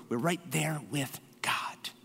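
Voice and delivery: soft breathy whisper; hushed, close to the mic. Real, not acted. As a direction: drop to a whisper for intimacy.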